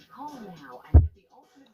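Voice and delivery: ordinary delivery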